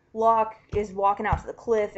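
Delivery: ordinary delivery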